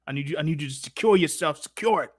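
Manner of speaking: usual speaking voice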